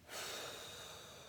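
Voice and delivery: long, soft breath out; deep exhale. Shown as a demonstration. Teacher demonstrating a deep relaxing breath, long exhale. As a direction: blow the air slowly out through the mouth. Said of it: "out from the mouth".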